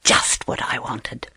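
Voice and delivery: low, soft, secretive; quiet, scheming hare; thinking to oneself. A narrator's reading. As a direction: murmur it privately. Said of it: "thought Hare".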